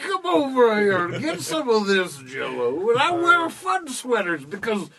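slow, high comedian voice; Bill Cosby impression. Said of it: "do the great Bill Cosby impression".

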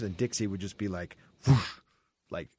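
ordinary talk, nothing marked

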